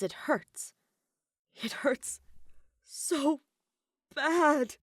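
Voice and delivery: anguished whimper building to a cry; pained inner plea. A narrator's reading. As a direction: plead through the pain, climbing as it breaks out. Character's pained inner thought.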